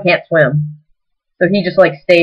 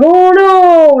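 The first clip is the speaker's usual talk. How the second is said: mock alarm; 10 semitones higher, 4 dB louder than usual. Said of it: "it's like".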